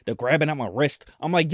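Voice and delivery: normal talking voice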